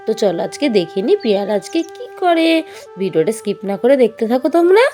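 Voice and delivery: ordinary delivery, nothing marked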